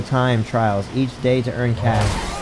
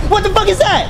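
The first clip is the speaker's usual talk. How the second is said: high startled cry of what; shocked outburst; 4 dB louder than usual. Real, not acted. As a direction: pitch jumps way up in startled disbelief. Sudden shock at game event.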